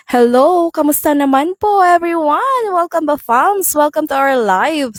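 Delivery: cheerful live-selling host calling to her fams; live-selling greeting. Shown as a demonstration. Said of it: "try natin kung kailangan talaga pa rin nating sumigaw".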